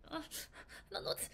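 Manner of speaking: high, breathy, half-cried worry; lightly acted agitated fret; anxious fretting. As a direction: act out an upset, anxious person. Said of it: "You're like".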